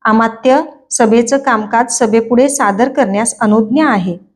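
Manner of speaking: ordinary delivery, nothing marked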